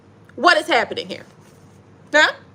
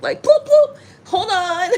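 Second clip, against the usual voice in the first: higher, laughing at the cards; 6 semitones higher, slower than usual. Real, not acted. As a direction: giggle through it.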